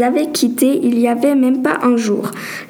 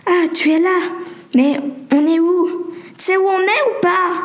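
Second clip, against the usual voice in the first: raised, insistent questioning; anxious questioning; 6 semitones higher than usual. Acted. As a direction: ask higher, pressing for an answer. Waking confused in a dark room.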